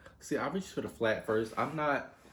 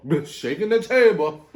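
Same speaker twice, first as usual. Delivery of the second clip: slow, much louder startled yell; loud outburst; 10 semitones higher, 14 dB louder than usual. Real, not acted. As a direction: shout it out, high and loud. Table shakes, she yells out.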